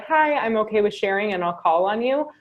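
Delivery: ordinary delivery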